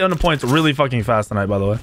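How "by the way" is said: usual speaking voice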